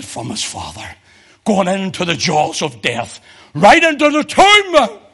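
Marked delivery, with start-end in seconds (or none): 3.5-5.0 much higher and louder, slow and drawn out; preaching climax. Acted. Preacher driving the congregation to the tomb, slow shout. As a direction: ring it out to the back row.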